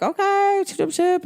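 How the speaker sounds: high, chirpy, playful agreement; mock cheerful okay. Agreeing to the locked-up ex's rules, chirpy. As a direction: breezily brush it off.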